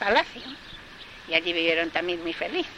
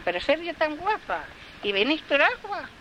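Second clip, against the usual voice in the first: higher, sing-song admiration of her own face; mock admiring woman; 9 semitones higher than usual. A narrator's reading. Woman at the fountain, admiring herself, high and sing-song. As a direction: preen at your reflection.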